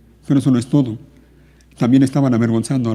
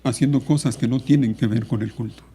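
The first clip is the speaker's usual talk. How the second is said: soft, sorrowful admonition; quiet, solemn pulpit appeal; grave, subdued preaching; 5 dB quieter than usual. Real, not acted.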